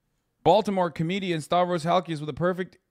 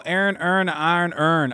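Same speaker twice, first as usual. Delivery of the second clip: mock Baltimore accent, raised pitch; 3 semitones higher than usual. Streamer quoting the accent's famous test phrase, mock Baltimore accent. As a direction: run the words together in a Baltimore accent. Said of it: "one of the most fucked up accents".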